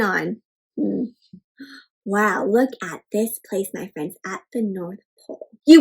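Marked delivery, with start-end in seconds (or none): none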